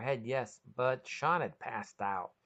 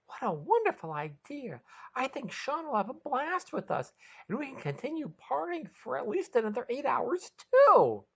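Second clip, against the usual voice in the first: high and gleeful, building to a squeal; thrilled, planning a long party; excited high voice; 13 semitones higher, 5 dB louder than usual. Acted. Maggie delighted at the idea, high and excited.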